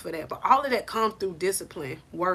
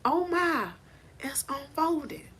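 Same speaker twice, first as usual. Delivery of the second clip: softer, awed, dropping to a whisper; soft wonder, fading into a whisper; hushed amazement; 4 semitones higher, 8 dB quieter, slower than usual. Pretend. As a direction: breathe it out like a secret revelation. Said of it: "you gonna be like".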